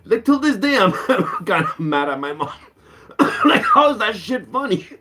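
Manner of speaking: high-pitched start, breaking into laughter; laughing in disbelief; laughing while talking. Real, not acted. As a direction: struggle to talk through the giggles. Laughing at his own painful story.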